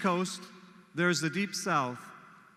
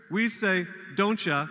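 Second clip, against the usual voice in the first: ordinary talk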